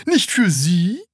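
high puzzled salesman question; surprised salesman. A narrator's reading. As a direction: lift it in polite surprise. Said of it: "fragte der Verkäufer".

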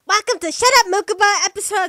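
loud, quick announcer-style intro; way too loud. Acted. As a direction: blast the episode intro. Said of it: "it's way too loud".

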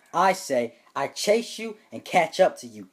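ordinary talk, nothing marked

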